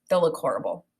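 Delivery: plain reading voice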